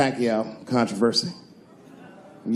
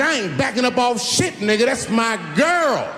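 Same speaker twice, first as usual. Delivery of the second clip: tough challenger; 9 semitones higher than usual. Retold or imitated. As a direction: refuse to back down, hammed-up. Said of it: "She said".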